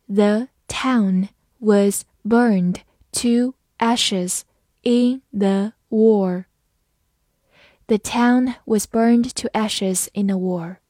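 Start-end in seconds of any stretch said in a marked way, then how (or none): 0.1-6.5 very slow, word by word; slow reading. Shown as a demonstration. Teacher modelling for students, very slow and clear. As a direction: read it slowly for the students to follow. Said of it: "好，慢慢来读。".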